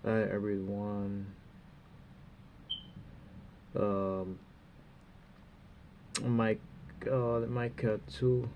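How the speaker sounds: usual speaking voice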